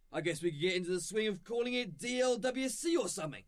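ordinary delivery

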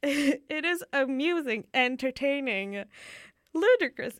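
bubbling over with amusement; laughing delight. Real, not acted. Listing synonyms for funny, laughing.

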